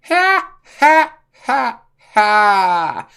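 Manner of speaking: high, loud put-on villain cackle; Joker cackle. Joker announcing himself to the squad, high loud cackle. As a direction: cackle like a gleeful madman.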